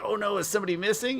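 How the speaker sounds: high, quick worried question; lightly hammed-up concern; mock alarm. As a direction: imitate a worried group, pitched right up. Acting out the group's worried reply.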